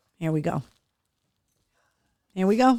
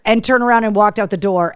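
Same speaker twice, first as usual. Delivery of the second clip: ordinary talk, nothing marked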